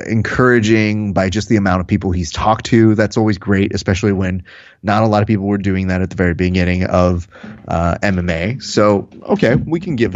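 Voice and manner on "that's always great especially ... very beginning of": normal talking voice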